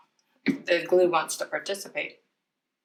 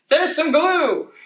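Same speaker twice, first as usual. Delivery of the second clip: surprised at the glue; 7 semitones higher, 5 dB louder than usual. Real, not acted. Crafter watching too much glue pour out, surprised. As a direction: let the surprise stretch out the words.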